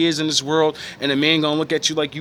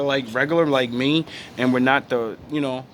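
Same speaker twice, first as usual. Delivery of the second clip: usual speaking voice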